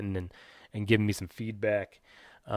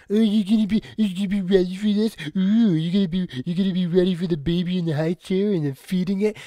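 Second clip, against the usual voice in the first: high, loud, mocking singsong of a nagging voice; mocking whiny voice; 7 semitones higher, 4 dB louder than usual. Ranting vlogger mocking baby-advice givers, high and whiny. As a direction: mimic the guilt-trippers in a silly high voice. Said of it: "this whole fucking guilt tripping".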